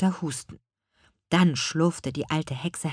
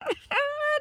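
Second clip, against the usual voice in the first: very high cackling laugh; witch cackle. A narrator's reading. Grandmother witch laughs at the curse idea, cackling. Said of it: "Ihre Großmutter lachte".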